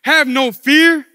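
loud, slow superhero announcement; hammed-up hero's entrance; heroic David. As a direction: proclaim it grandly, with a long dramatic pause. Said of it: "runs in with his hands on his hip".

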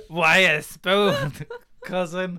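very slow, raspy whine, breaking into a laugh; mock villain rasp through giggles; weird raspy voice. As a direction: drag it out in a silly rasp. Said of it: "weird raspy voice for no reason".

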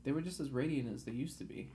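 ordinary delivery, nothing marked

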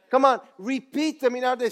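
quick, rousing call to the crowd; quick rallying call; urging. Shown as a demonstration. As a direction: fire them up fast. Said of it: "Say it with me".

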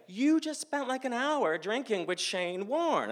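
very high-pitched, amazed disbelief; incredulous friends. Retold or imitated. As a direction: spell it out in high, stunned excitement.

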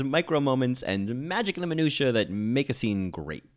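normal talking voice

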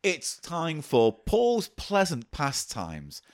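slow grand segment announcement; announcer voice. Pretend. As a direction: announce the title slowly, like a host.

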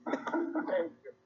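high laughing thank you. Real, not acted. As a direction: say it through real laughter.

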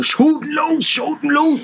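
high-pitched frantic command, no real volume; imitated sailor's yell. Retelling the instructor on the tilting boat, mock yell. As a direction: mimic a sailing instructor yelling orders. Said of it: "Schrie er so".

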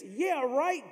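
high scoffing yeah right; sarcastic. Acted. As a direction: sneer it out in disbelief. Mocking disbelief, much higher.

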